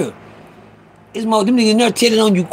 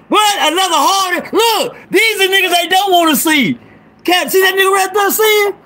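higher, shouted hype; 6 semitones higher than usual. Real, not acted. Hyping his friend Calvin to the live viewers, excited shouting.

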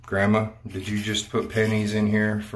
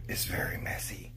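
whispering; 2 semitones lower, 11 dB quieter than usual. Real, not acted. Joking aside about messy room.

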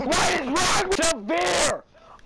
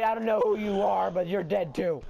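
normal talking voice

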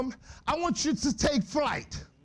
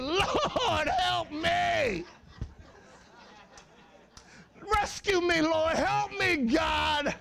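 high shouted plea for help; screaming to God; 5 semitones higher, slower than usual. Retold or imitated. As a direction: cry out to the sky, Lord help me. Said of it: "I just screamed out".

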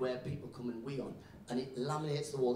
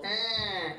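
high, loud creaking noise; imitated door creak; 6 semitones higher, 5 dB louder than usual. Shown as a demonstration. Comic mocking Scottish word for door, as creak. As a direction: make a creaky door sound. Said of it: "you use sounds of what it sounds like".

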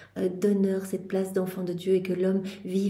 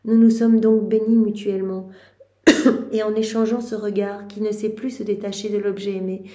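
louder, earnest devotional reading; fervent reading; 5 dB louder than usual. Acted. Prayer leader reading Mary's lament to the group, louder. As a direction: read it out with rising fervour. Reads the passage aloud.

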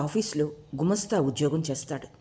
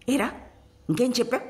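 mildly raised, higher angry demand; roaring father; 7 semitones higher, faster than usual. A narrator's reading. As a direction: demand it like an angry father, without full volume. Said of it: "నాన్న గర్జించాడు".

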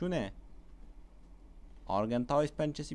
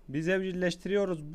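ordinary talk

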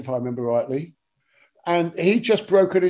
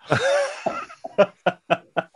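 high burst of laughter; big laugh. Real, not acted. Laughing at own punchline.

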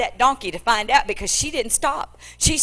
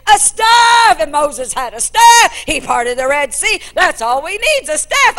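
much higher, louder shouted excitement; hammed-up eager believer; mock enthusiasm; 10 semitones higher, 10 dB louder than usual. Preacher mocking easy formulas.

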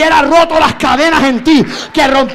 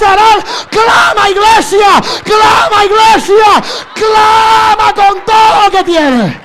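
higher, fervent crying-out sermon; preaching at full force; 6 semitones higher, 4 dB louder than usual. Acted. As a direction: drive the church to cry out. Preacher urging church to cry out.

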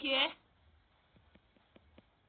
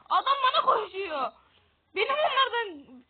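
panicked yell; 6 semitones higher, 8 dB louder than usual. Real, not acted. Panicked gamer as enemy charges.

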